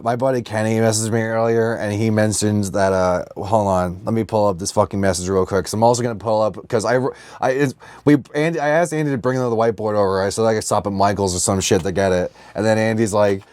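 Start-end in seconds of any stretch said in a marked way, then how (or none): none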